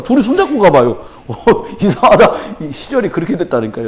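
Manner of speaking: ordinary talk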